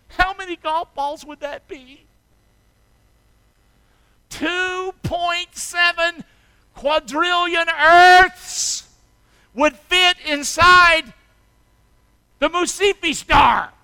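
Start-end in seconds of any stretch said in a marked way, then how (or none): 4.3-13.7 high, slow, drawn-out preaching; slow dramatic reveal. Acted. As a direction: lay each word down for effect.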